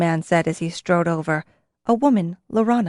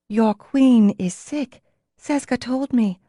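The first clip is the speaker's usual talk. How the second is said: gently contradicting, slow; firmly correcting the order; in disagreement; 4 semitones higher, slower than usual. A narrator's reading. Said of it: "said in disagreement".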